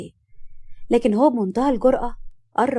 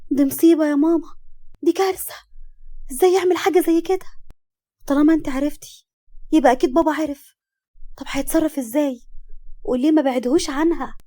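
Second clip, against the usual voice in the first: higher-pitched, dismayed questions; acted panic, voice lifted; shocked alarm; 5 semitones higher than usual. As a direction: react as if it's a disaster. Daughter shocked by mother's secret.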